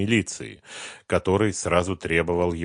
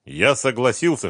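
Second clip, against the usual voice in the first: ordinary delivery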